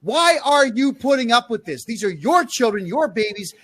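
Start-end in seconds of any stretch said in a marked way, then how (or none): 0.0-1.9 loud, high angry yell; scolding parents with a real yell. Real, not acted. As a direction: rail at the parents. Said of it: "Now I'm gonna yell at you for this".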